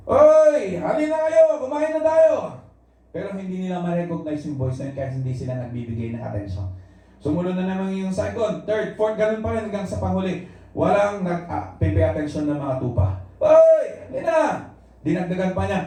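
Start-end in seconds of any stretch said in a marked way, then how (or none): 0.1-2.6 a stranger calling the sheep to come and eat. Retold or imitated. 13.4-14.7 high, slow drawn-out call; a second stranger calling the sheep. Retold or imitated.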